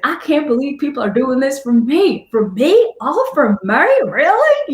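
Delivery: high, overwhelmed retold disbelief; imitated tearful gratitude. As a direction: voice a thankful man moved to tears, accent and all. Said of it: "he's in tears".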